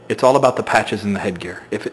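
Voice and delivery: ordinary talk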